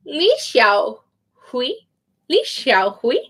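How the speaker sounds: "Li Xiaohui" said slowly, rising on "Li"; slow, careful name reading. Shown as a demonstration. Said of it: "gonna be wrong".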